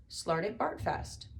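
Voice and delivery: plain reading voice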